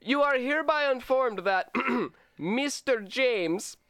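hammed-up Russian video game villain; cheesy Russian bad-guy accent. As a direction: read it like an eighties-movie Russian bad guy. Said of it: "Russian video game bad guy".

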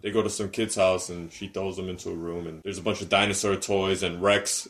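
usual speaking voice

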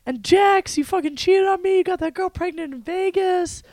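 indignación fingida. Acted. Imagines her yelling at her ex.

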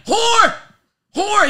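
shrieked high and loud; a woman's furious shriek. Retold or imitated. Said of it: "She's like".

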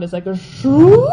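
a loud, slow, high whoosh like rushing air; imitated whoosh. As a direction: mimic the sound of being sucked away, drawn out. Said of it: "I heard kind of this sound".

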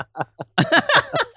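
laughing high at himself; real laugh at his own bit; high burst of laughter. Real, not acted.

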